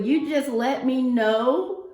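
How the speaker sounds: ordinary delivery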